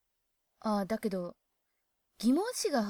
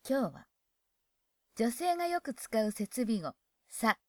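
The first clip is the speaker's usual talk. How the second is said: ordinary talk, nothing marked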